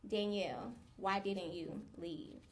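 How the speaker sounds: soft, low, slow plea; quiet reproach. Retold or imitated. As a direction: ask it slowly, sinking in pitch and volume. Same reproach, lower and slower.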